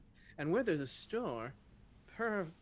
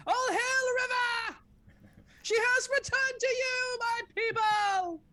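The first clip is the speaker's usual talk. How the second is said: high, loud, slow regal proclamation; rallying proclamation; 6 dB louder, slower than usual. Acted. Character proclaiming River's return to her people, loud and slow. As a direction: hail her like a herald to the crowd.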